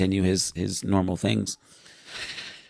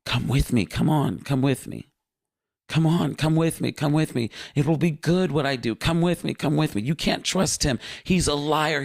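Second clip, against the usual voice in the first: higher, coaxing, insistent; pleading Lucifer; 7 semitones higher than usual. Retold or imitated. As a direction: lure him over with sweet urging. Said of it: "he is saying".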